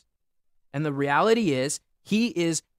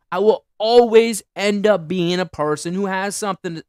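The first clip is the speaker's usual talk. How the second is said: usual speaking voice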